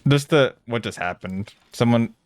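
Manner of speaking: ordinary talk, nothing marked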